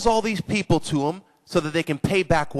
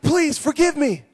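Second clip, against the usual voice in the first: high, acted plea; begging forgiveness; 8 semitones higher, 4 dB louder than usual. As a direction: plead as a debtor on his knees. Debtor on knees begs forgiveness.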